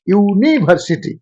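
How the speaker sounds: "यूनिवर्सिटी" slowly, with ee-oo drawn out at the start; teacher's slow demo of "यूनिवर्सिटी"; showing pronunciation. Shown as a demonstration. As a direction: stretch "यूनिवर्सिटी" into ee-oo-niversity. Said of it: "इसका उच्चारण होता है".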